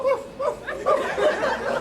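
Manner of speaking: high squeaky yapping bark; little dog woofs, high and breathy; small dog yap. Retold or imitated. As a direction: yap like a tiny poor man's dog. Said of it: "the dog voice style".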